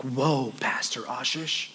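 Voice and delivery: low, soft, drawn-out shocked voice; hushed, scandalized whoa from the pews; mock scandalized churchgoer. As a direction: play the shocked congregant gasping at the pastor. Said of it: "some of y'all are like".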